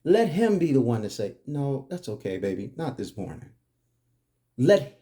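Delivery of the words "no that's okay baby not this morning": soft, low, easy let-down; gentle refusal. Acted. Husband gently putting off his wife in the morning, soft and low. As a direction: turn her down tenderly, voice dropping away.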